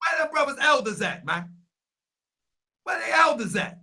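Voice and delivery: hey Sam, loud; calling out. Real, not acted. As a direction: shout over to Sam to get his attention.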